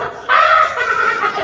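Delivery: loud, high mock laugh of three old women; imitated burst of laughter. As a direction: burst out like tipsy grannies laughing. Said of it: "이렇게 웃어요". (할머니들처럼 크게 터지는 웃음 흉내)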